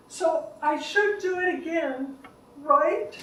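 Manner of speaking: eager, unsure caregiver seeking approval; [name]. Acted. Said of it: "Is this [name]?".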